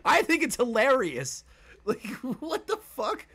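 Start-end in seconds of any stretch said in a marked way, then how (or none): none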